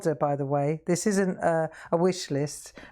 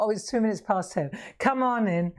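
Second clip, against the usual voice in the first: ordinary talk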